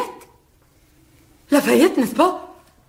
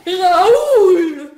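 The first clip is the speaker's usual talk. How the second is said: high, loud call with a laugh; 9 semitones higher, 8 dB louder than usual. Acted. Vicomtesse calling Raoul back, loud and high. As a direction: call him back loudly.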